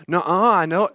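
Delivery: put-on sulky kid insisting; a stubborn child arguing back; protesting child.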